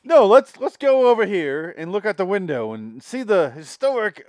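loud, high hammed-up socialite; waspy interrupter. Waspy couple talking over the storyteller, hearty loud voice. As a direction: steer everyone away, overriding the story. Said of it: "the waspy couple's like".

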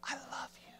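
very soft, intimate whisper; God's tender whisper. Acted. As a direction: whisper it softly, as God to a loved one. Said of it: "when he whispers, he says".

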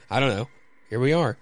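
usual speaking voice

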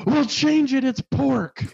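high, loud mock yell; angry cook. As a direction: snap it out like a cook losing it. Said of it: "And he's like".

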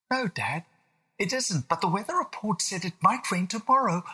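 put-on high, anxious child voice; a small boy already fretting; worried little William. William tells dad the forecast, worried child voice.

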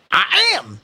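put-on high, loud old-timer; old man. Old man confirming he is a grandpa, proud. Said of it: "the old man said".

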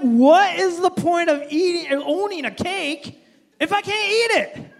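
loud, high, exasperated protest; comically indignant, nearly shouting; mock outrage. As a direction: demand an answer at full volume. Acted protest at cake saying.

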